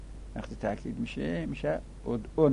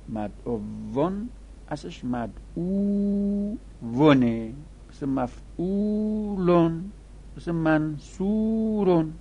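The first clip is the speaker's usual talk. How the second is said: higher and slow, each form laid out clearly; slow emphatic teaching; 6 semitones higher, slower than usual. Shown as a demonstration. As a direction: spell it out slowly for the students. Teacher spells out the original form. (مدعوون را بلندتر و کشیده بگو)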